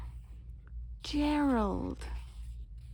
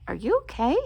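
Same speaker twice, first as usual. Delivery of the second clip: ordinary delivery, nothing marked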